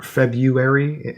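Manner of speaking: FEBRUARY as Feb-yoo-ary, drawn out; colloquial February. Shown as a demonstration. Explaining to co-host how people say it, February as FEB-yoo-air-ee. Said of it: "they say Feb".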